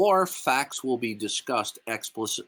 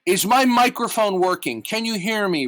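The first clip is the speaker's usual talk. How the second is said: louder and higher, mocking "is this on"; loud sarcastic mic check; 7 semitones higher, 5 dB louder than usual. Real, not acted. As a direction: call out as if unheard. Sarcastic mic check mid-argument.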